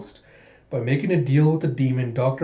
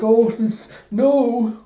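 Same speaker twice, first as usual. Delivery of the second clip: mock dismay; 10 semitones higher, 4 dB louder than usual. Character reacts to being stuck.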